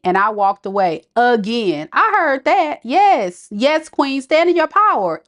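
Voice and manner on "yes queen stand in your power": ordinary talk, nothing marked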